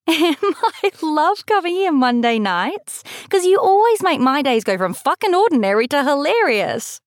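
high voice full of laughter; helplessly amused, high and lively; bursting out laughing. A narrator's reading. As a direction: gush it while laughing. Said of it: "Lily bursts out laughing".

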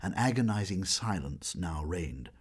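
ordinary delivery

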